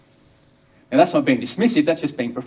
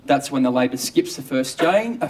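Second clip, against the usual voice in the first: usual speaking voice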